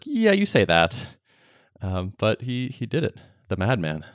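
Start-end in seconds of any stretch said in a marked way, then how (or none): 0.0-1.1 high skeptical sneer; doubtful, teasing put-down; sarcastic. Retold or imitated. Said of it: "And I was like".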